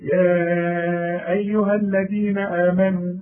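slow recitation stretching أيها to four beats; tajweed recitation. Shown as a demonstration. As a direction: draw out the vowel in أيها, slow and chanted. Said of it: "كل مد بعده همز بنمده أربع حركات".

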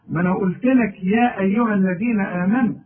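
ordinary delivery

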